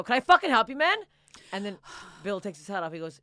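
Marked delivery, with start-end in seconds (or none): 0.0-1.0 loud, higher, hostile "can I help you"; aggressive snap. Retold or imitated. Nervous comic to approaching stranger, loud hostile challenge. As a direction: bark it at the stranger like he's a threat.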